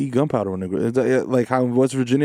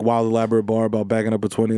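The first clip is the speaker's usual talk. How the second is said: ordinary talk, nothing marked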